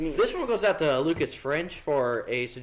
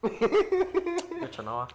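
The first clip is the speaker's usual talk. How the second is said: very slow, high words through laughter; laughing; 11 semitones higher, 4 dB quieter, much slower than usual. Real, not acted. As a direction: say it while cracking up. Laughing reply.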